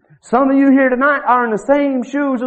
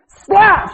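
high, sharp slap sound; a loud imitated slap; mock slap; 11 semitones higher, 4 dB louder than usual. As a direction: smack it out.

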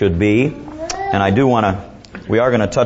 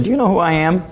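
higher, pushy big-city lawyer voice; arrogant big shot lawyer; 6 semitones higher, faster than usual. Retold or imitated. New York lawyer threatens the old farmer, higher and arrogant. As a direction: puff up like a bully lawyer making threats. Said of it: "And the lawyer said".

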